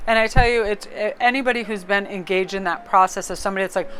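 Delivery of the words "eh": ordinary talk, nothing marked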